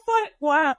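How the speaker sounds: crying, worn out. Real, not acted. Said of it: "I can't stop crying".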